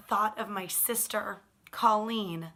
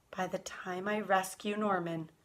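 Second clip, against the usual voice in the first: plain reading voice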